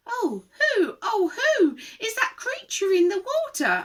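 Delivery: high, questioning character voice; wondering young dinosaur. A narrator's reading. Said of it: "and asked them".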